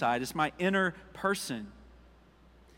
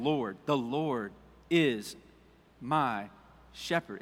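personal, slow; 4 semitones lower, 3 dB quieter, much slower than usual. Shown as a demonstration. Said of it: "It's personal".

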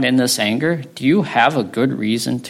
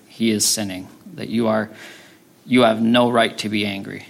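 usual speaking voice

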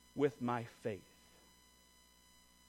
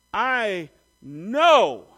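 loud, drawn-out declaration of faith; firm conviction; 8 dB louder than usual. Retold or imitated. Woman voicing her faith in Jesus, firm conviction. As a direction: land it with total certainty, loud on the last word. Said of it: "That is faith and expectation".

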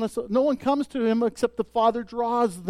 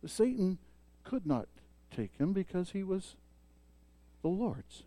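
hushed emphasis; 3 semitones lower, 13 dB quieter than usual. Real, not acted. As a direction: let each phrase land softly. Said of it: "softened emphatic conclusion".

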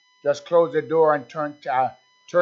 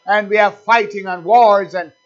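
loud, raised praise, leaning on key words; fervent preaching; 3 semitones higher, 6 dB louder than usual. Real, not acted. Preacher extolling the blood to the church, fervent exhortation. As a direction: exalt it with forceful, emphatic cries.

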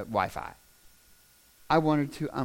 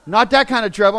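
louder, higher imitated correction; exasperated wife; 6 semitones higher, 10 dB louder than usual. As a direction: cut him off as the exasperated wife. Wife corrects him in retold quarrel.

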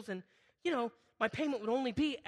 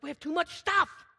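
shouted high in exasperation; irritated outburst; 8 semitones higher, 6 dB louder than usual. Retold or imitated. Pastor hauling stuff downstairs with his wife, shouting in irritation. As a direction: blurt it out, fed up with the clutter. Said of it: "I'm getting irritated".

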